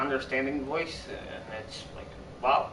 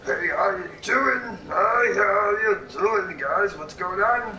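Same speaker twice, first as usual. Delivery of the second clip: higher, drawn-out silly greeting voice; higher goofy character voice with a laugh; put-on voice; 4 semitones higher, 3 dB louder, slower than usual. Shown as a demonstration. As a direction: greet the guys in a silly put-on voice, tacking on a giggle. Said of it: "do different kind of voices".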